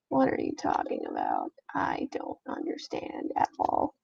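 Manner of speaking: hushed, puzzled self-talk; baffled muttering. Real, not acted. Baffled muttering at computer trouble.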